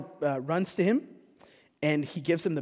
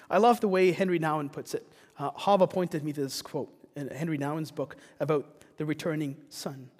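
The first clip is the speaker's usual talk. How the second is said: ordinary talk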